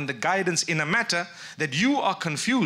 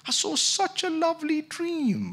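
high, drawn-out gushing; mock dreamy believer; 11 semitones higher than usual. Preacher mimicking people who believe in dream signs, mock dreamy. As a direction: gush slowly in a high put-on voice.

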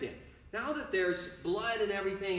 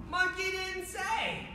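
very high, slow teasing call; playful gotcha; 12 semitones higher, slower than usual. Pretend. Coach teasing kids caught out, playful gotcha.

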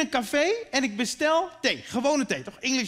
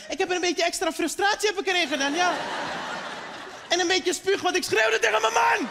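sarcastic mother; 7 semitones higher than usual. Retold or imitated. Mother telling a guest what's in the nasi, biting sarcasm. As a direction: let the frustration spill out sweetly.

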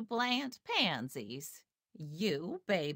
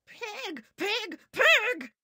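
Eugenia shouting; much slower than usual. A narrator's reading. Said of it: "shouted Eugenia".

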